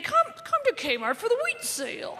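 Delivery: high imitation of Martha Stewart saying "wheat sale"; Martha Stewart mispronouncing. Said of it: "She keeps saying".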